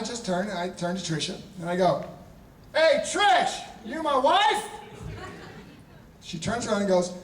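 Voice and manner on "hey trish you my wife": high playful call. Retold or imitated. Calling to Tricia across party.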